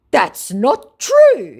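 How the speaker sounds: high, loud, very slow whiny denial; mock scammer denial.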